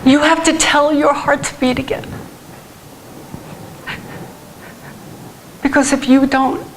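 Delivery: slow, halting plea through tears; real tearful. Real, not acted. Preacher urging congregation to speak to the bones, tearful. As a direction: choke out each phrase with long pauses. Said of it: "I don't know why I'm crying".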